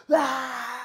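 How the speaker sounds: high, loud mock shriek; imitated loud scream. Woman scared by keyboard playing itself, imitated scream. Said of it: "screaming so loud".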